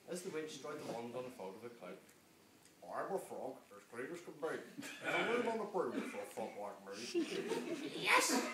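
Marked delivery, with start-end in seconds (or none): 2.8-7.9 croaky frog. Acted. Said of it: "then said with a croak". 8.0-8.4 high loud witch shout; high shouted yes; witch cry. Acted. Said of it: "cried the witch".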